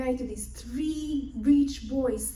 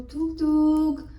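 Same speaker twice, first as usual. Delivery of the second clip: mimicked knock; 4 semitones higher, 6 dB louder than usual. Knocking on the door.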